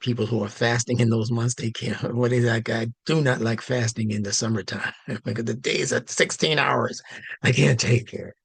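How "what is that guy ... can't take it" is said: amused, laughing through the words. Real, not acted. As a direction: chuckle while joking. Joking about long summer fasts.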